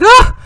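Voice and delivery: high, loud "aha" gasp; cry of realisation. Acted. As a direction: cry out as it dawns on you. Dad's sudden realisation.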